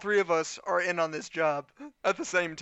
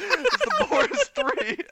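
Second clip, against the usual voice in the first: mock announcer; 6 dB louder, slower than usual. Joking about the fake names.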